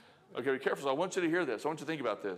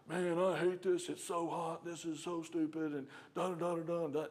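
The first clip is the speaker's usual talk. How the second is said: whiny coworker; 6 semitones higher than usual. Retold or imitated. Preacher imitating a griping coworker in a high whine.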